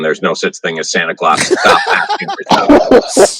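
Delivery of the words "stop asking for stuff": exasperated parent. Retold or imitated. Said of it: "parents had to basically come out".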